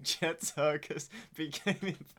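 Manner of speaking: loud, slow, laughing through words; can't keep it together. Real, not acted. Cracking up while narrating Chet.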